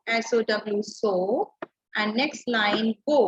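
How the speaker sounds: so and go spelled out very slowly; spelling demo. Shown as a demonstration. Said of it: "Next is so". (धीरे-धीरे spelling के साथ so और go)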